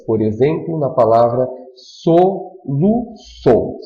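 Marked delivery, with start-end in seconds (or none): 1.7-3.8 "soluçou" slowly split into so, lu, çou; syllable by syllable. Shown as a demonstration. Teacher counting three syllables, slow split "soluçou". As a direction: break "soluçou" into three slow, separate beats. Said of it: "São três sílabas".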